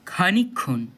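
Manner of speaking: ordinary talk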